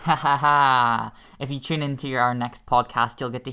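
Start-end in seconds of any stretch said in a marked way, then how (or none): 0.0-1.1 slow, drawn-out evil clown cackle; evil clown laugh. Acted. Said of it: "Favorite evil clown voice".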